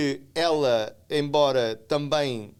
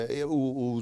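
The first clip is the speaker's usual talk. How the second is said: normal talking voice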